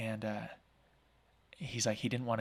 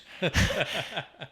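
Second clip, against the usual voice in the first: laugh; 7 semitones higher, 5 dB louder than usual. Real, not acted. Laughing at own joke.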